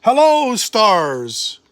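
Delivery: very high, loud burst of welcome; joyful greeting. A narrator's reading. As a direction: sing out hello to the stars. God greets creation joyfully.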